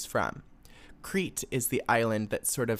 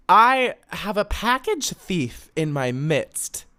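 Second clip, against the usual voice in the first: dramatic reveal; 6 semitones higher, 6 dB louder, slower than usual. Real, not acted.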